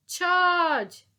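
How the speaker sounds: loud, high battle cry; shout. Acted. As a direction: yell it like a charge. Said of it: "cried Titch".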